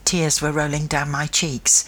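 ordinary delivery, nothing marked

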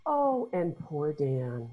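soft, slow, drawn-out sympathy; mock pity. TV viewers feeling for the husband, mock pitying. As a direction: sigh over him in put-on pity.

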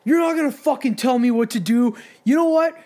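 loud, high retold shout, voice cracking up; angry yell at the bouncer. Buddy yelling at the bouncer, retold as a loud high shout. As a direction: bark it at him like he owns the place. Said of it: "and is like".

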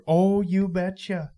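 put-on slow, drawn-out Minnesota accent; a light Minnesota accent on the catchphrase. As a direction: stretch it out like a lake-country Minnesotan. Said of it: "you know, accent".

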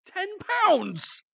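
real squawk of astonishment; loud disbelief. Real, not acted. Friend hears the eagle weighs ten pounds, incredulous cry.

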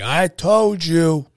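loud, very slow scolding; drawn-out reproach. Retold or imitated. Imitating dad's blaming phone message, drawn-out reproach. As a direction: stretch each word in mock blame.

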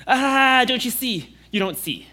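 put-on caring father warning a child; fatherly voice. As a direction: call out like a worried father. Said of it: "that fatherly heart that says".

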